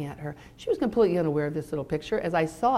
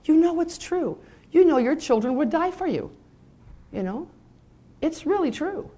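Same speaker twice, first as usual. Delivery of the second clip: higher, louder pleading insistence; impassioned; 10 semitones higher, 4 dB louder than usual. Real, not acted. Preacher urging parents that their children love them, impassioned. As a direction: press the truth home with fervour.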